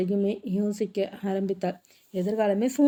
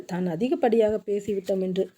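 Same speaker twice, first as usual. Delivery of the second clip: plain reading voice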